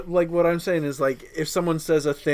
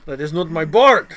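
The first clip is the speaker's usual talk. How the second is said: louder, vaguely Russian villain voice; a bad Eastern European slash Russian accent, hammed up; terrible Eastern European accent; 3 semitones higher, 10 dB louder than usual. Joking with his friend, a bad Eastern European accent. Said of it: "That was a terrible accent".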